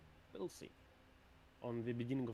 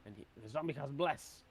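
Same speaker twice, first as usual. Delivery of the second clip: ordinary delivery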